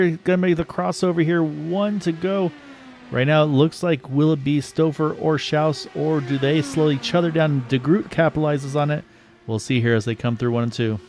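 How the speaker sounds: building race call; play-by-play. Acted. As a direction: call the last lap live. Calling the final laps live.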